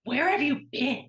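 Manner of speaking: frantic mom. Retold or imitated.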